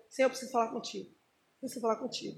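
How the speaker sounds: low, soft plea to God; quiet, reverent request; hushed prayer. Acted. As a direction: speak softly, as if privately asking God. Models an orderly, humble request.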